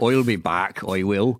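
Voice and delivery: put-on mild Austrian-accented "I'll be back", lightly done; a hint of Arnold's Terminator line. Riffing on the Terminator quote in a light Austrian accent. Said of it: "Farmer Terminator".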